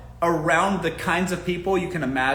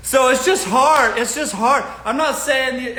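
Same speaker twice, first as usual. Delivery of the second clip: laughing; 8 semitones higher, 6 dB louder than usual. Real, not acted. Laughing after joke.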